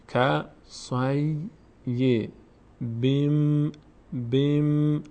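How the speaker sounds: slow, separate syllables with held endings; drawn-out spelling-out of a recited word; syllable drill. Shown as a demonstration. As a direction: sound out each piece for learners. Teacher sounds out word in syllables.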